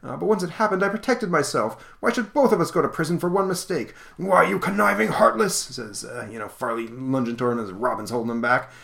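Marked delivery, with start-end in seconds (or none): 0.0-3.9 put-on whiny old miser. Scrouge insists he's innocent too, high defensive whine. 4.2-5.6 much higher, louder mock rage; hammed-up fury. Convict lunges at his old partner, hammed-up rage.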